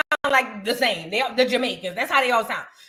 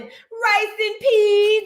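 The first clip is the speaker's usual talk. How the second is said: higher, slow mock Jamaican drawl; 8 semitones higher, much slower than usual. Mocking the loud girl's put-on Jamaican, slow and higher. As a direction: parody the girl's fake island accent. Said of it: "she was just loud and".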